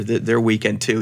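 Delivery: ordinary talk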